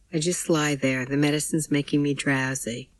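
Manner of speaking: plain reading voice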